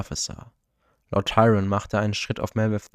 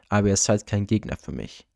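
usual speaking voice

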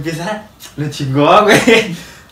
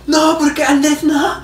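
imitating a schoolgirl begging her boyfriend to stay; begging teen girl; 12 semitones higher, slower than usual. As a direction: beg Andrés not to leave in a high whine. Girl pleading with Andrés.

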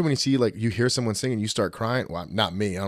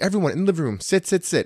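higher, insistent mock order to sit; bossy aunt; 9 semitones higher, faster than usual. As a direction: herd everyone into seats. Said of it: "it's like".

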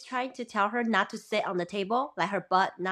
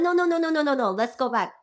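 insistent refusal; 5 semitones higher, 4 dB louder, faster than usual. Retold or imitated. Wife overruling husband, retold.

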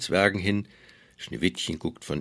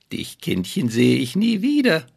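gloating evil queen; 8 semitones higher, 6 dB louder than usual. Acted. Queen gloats over victim.

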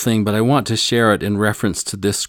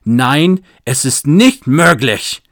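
louder, higher, slow defiant German no; the clerk defiantly turning down the missionary's license; defiant refusal; 4 semitones higher, 7 dB louder, slower than usual. Retold or imitated. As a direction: plant your feet and refuse him. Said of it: "shook his head defiantly and said".

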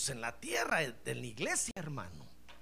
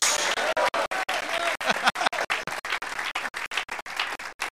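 breathy laugh; 9 semitones higher, 3 dB louder than usual. Real, not acted. Preacher laughs after the shout.

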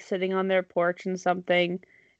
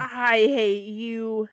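very drawn-out mock-hateful grumble; bickering old spouse; much slower than usual. Old spouse answering partner's insult, mock hateful and slow. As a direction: stretch it slowly in a put-on elderly voice. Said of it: "like, an old couple".

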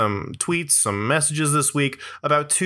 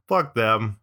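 ordinary talk

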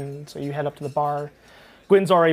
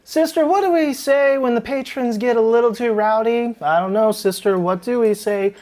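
higher, theatrical sing-song; a tavern keeper's rehearsed bit; sisters' routine; 7 semitones higher, 5 dB louder than usual. Acted. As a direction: play it up for the crowd. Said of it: "calls out to the other sister and is like".

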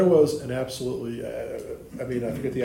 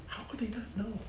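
"How could they not know?" higher but much softer, rising on "they"; wondering aloud; 10 semitones higher, 10 dB quieter than usual. Acted. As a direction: ask it softly to yourself, high and hushed. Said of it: "you never really think about it".

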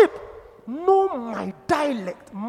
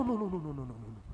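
soft dropped no's; 9 semitones lower, 11 dB quieter, much faster than usual. Real, not acted. Soft repeated refusal.

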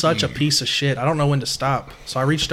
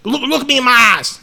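higher, louder coach voice, demanding; mock coach; 8 semitones higher, 6 dB louder than usual. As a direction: imitate the coach getting in his face. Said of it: "was like".

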